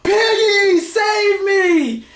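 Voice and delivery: desperate high yell for Piggy; yelled plea. Retold or imitated. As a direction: scream for the pig to rescue you. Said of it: "all I could think to yell was".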